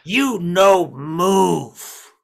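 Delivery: menacing goblin. Acted. Goblin holds elf at crossbow point, loud menacing threat. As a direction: order them to freeze in a big goblin voice.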